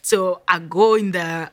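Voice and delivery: ordinary delivery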